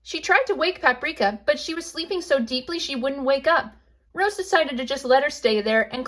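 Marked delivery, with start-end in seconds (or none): none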